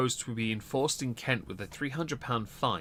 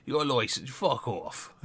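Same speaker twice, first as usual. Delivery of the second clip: put-on a light rough Kent accent, blunt border guard; gruff Kent local telling a driver to get lost, in a mild Kent accent; 2 semitones higher than usual. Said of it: "Kent has a few accents. that one I hear quite a lot".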